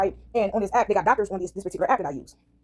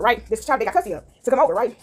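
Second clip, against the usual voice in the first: normal talking voice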